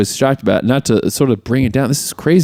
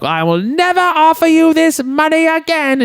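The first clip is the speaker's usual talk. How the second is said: put-on much higher, slow imperious old lady; haughty grandmother. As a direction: lay down the final word slowly. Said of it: "the Martha's like".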